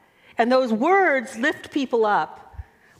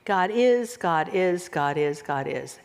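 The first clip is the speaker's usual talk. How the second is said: fading mantra; 7 semitones lower than usual. Shown as a demonstration. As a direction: let the chant sink and die away. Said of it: "you do it over and over".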